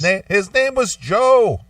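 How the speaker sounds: put-on Joe in a high Indian accent; a light Indian accent on Joe. Friend mocks caller's name in Indian accent. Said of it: "thick Indian accent".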